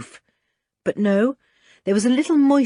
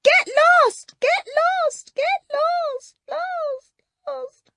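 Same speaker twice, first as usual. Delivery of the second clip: echo; much slower than usual. Acted. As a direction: let it ring away down a tunnel. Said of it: "along came the echo".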